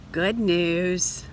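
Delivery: ordinary delivery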